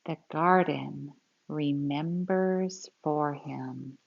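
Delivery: put-on lively, expressive read-aloud for kids; storybook reading. Teacher reading a grandpa story to children, expressive read-aloud. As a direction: read it like a picture book to a class.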